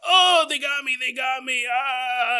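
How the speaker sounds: high, loud mock cry of a shot soldier; wounded cry. Wounded soldier crying out to his squad, hammed-up cry of pain. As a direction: shout it like you just got hit.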